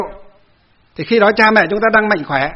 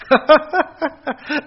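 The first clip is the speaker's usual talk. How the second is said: high laugh at the absurd memory; amused laugh; 8 semitones higher than usual. Real, not acted. The monk laughing while retelling the neighbor's insults, high laugh.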